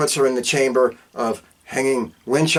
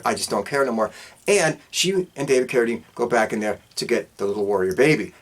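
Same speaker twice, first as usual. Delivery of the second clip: usual speaking voice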